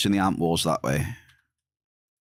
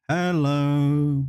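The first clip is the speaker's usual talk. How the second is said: a slightly raised mock game-voice hello; a first light try at the sound effect; imitating game greeting; 4 semitones higher than usual. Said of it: "Something like that, it goes".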